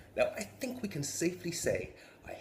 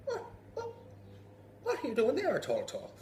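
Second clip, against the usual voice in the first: high startled local voice; startled villager. Acted. Villager catching the devil, startled and high. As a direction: squeak out a shocked challenge.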